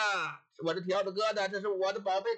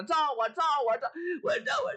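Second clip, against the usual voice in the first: shrewish shriek; 6 semitones higher than usual. Acted. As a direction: pitch climbing to a shrill high peak. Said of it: "撒起泼来了".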